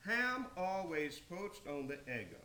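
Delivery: usual speaking voice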